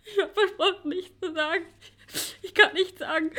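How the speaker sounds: sobbing through the words; tearful. Acted. Charlotte torn over her promise, tearful. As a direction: choke up as you try to keep the secret.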